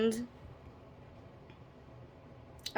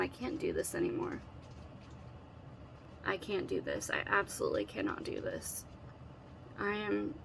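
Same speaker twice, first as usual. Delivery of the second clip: exhausted whisper; 9 dB quieter than usual. Retold or imitated. Telling husband she's exhausted.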